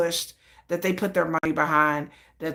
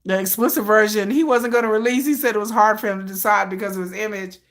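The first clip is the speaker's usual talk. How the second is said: usual speaking voice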